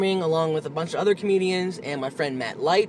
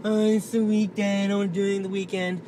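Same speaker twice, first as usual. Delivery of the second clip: whiny mock quote; 3 semitones higher than usual. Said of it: "You're always talking about".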